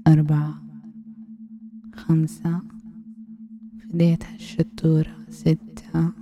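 counting one to ten in a firm, hushed voice; hypnotic countdown. Acted. Aunt counting her nephew down to sleep, firm hypnotic count. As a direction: count him down into sleep, numbers pressed out.

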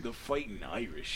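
slow, soft, botched Irish brogue; a bad Irish accent, dragged out; awful Irish accent. Friend tries the Irish accent again, slow and soft. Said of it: "that's fucking awful".

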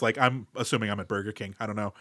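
ordinary delivery

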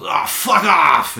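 loud, drawn-out, high retold yell; drunken angry shout. Passed-out drunk woken by a cop's light, angry shout.

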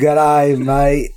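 playful imitation Australian; Australian accent. Shown as a demonstration. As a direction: repeat it back in a put-on Aussie accent. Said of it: "Say it with me".

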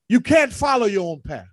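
usual speaking voice

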